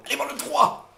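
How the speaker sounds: loud, high hammed-up sequel teaser voice; mock trailer announcer. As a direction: shout it like a cheesy movie ad. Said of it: "c'était trop genre".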